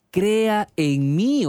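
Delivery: slow, high, lifted prayer; prayerful plea. Retold or imitated. Pastor voicing David praying to God, slow and lifted. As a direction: stretch it out like a psalm.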